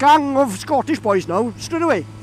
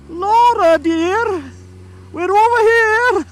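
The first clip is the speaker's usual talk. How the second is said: high, loud, drawn-out posh call; mock posh parent calling over; well-to-do parents; 9 semitones higher, 5 dB louder, much slower than usual. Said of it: "they look very well to do".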